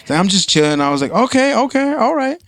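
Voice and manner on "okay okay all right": high, easygoing "okay"; lilting high reply; mock agreeable. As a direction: pitch it up, playing along. Acted own reply in story.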